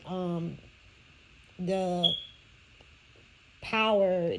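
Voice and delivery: ordinary talk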